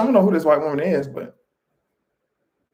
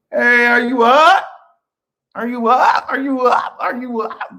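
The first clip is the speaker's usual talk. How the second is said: calling out; 6 semitones higher, 4 dB louder than usual. Pretend. Calling out to wake viewers.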